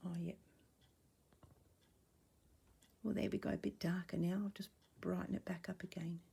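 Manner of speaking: soft, half-whispered chatter; hushed, as if not to disturb; talking quietly. Real, not acted. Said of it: "don't know why I'm talking quietly".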